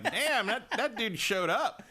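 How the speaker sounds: high, slow, laughing disbelief; amazed. Retold or imitated. To co-host, recalling Sam Lake's entrance: high laughing amazement. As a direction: draw it out, stunned and amused.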